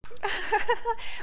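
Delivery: bright high laugh; amused laugh. Real, not acted. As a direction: let out a quick amused laugh. Wife laughs at question.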